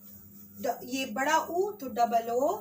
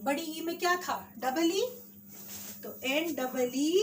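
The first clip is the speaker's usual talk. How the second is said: ordinary delivery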